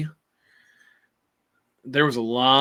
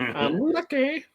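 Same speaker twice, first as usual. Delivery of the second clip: much higher, drawn-out squeak; high slow voice; 12 semitones higher, 3 dB quieter, much slower than usual. Acted. Joking about his weight.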